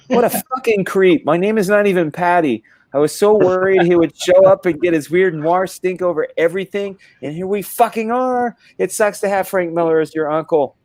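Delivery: high, put-on indignant rant; exasperated niece. Niece rants about her creepy uncle, high mock outrage. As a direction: act a fed-up niece, pitched well up.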